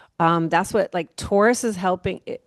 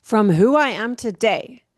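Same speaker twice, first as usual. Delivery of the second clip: normal talking voice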